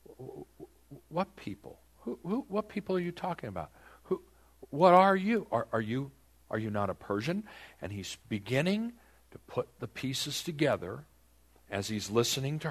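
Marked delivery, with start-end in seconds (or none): 0.0-7.5 stammering, confused and higher; a king caught off guard, sputtering; flustered king. Retold or imitated. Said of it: "He's probably looking to go".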